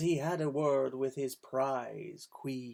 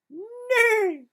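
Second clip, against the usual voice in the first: high, loud neighing; whinny like a horse; 10 dB louder than usual. A narrator's reading. Storyteller neighing as the horse. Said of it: "said the horse".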